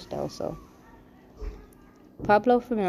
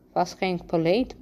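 usual speaking voice

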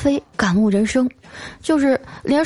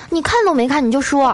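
put-on higher-pitched, miffed girlfriend; a bit angry; 6 semitones higher than usual. Girlfriend scolding boyfriend glued to his game, mildly cross. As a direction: complain in mock annoyance. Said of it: "有点生气了".

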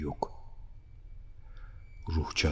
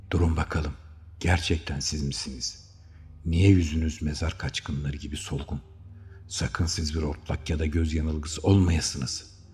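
panicked questioning; 10 dB louder than usual. A narrator's reading. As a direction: get more frantic with every question. Terrified friend questioning Panadin. (dehşet içinde giderek yükselen bir sesle)

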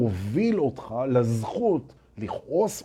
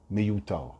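ordinary talk

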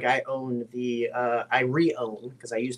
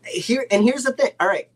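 excited rant; 6 semitones higher, 4 dB louder than usual. Real, not acted. Launching into rant.

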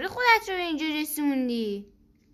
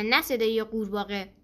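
plain reading voice

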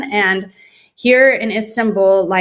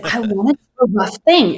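put-on Turkish accent. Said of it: "And he said".

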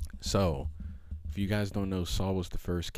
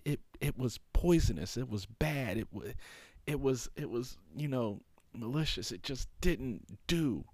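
high, earnest scolding plea; voice of God; 9 semitones higher than usual. Retold or imitated. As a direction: plead and reprove from above, voice lifted high. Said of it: "the Lord is telling him".

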